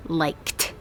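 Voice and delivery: "liked" ending in kt; a clean k then t closing "liked". Shown as a demonstration. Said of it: "the last sound is K".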